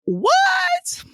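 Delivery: scandalized squeal at the author; mock shocked outburst. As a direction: cry out as if the author just blindsided you. Acting out shocked reaction to book.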